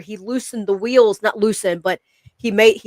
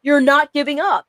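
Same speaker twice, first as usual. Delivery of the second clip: firm coaching dad, pitched up; retold pep talk, higher and insistent; imitating tough dad; 6 semitones higher than usual. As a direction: urge him on like a demanding father. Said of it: "I'm hard on him".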